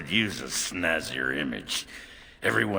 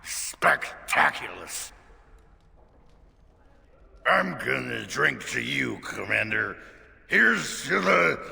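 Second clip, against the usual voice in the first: put-on drunk; 8 semitones higher than usual. Drunk toast to commander.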